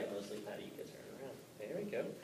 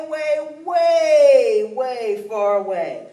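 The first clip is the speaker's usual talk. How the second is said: slow, loud, rising excitement; climactic telling; 8 semitones higher, 9 dB louder, slower than usual. A narrator's reading. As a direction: build to a thrilling climax. Climax of the story.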